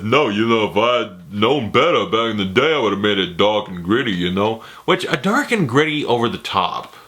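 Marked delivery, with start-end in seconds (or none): 0.0-4.6 slurred, low tough-guy impression; Stallone. Stallone talking about remaking his film, slurred impression. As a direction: mumble it in a thick movie-star slur. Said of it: "Stallone comes out and says".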